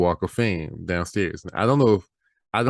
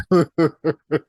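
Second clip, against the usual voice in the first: high, loud, breaking into laughter; laughing warning; 9 semitones higher, 4 dB louder than usual. Retold or imitated. Retold friend warning, laughing.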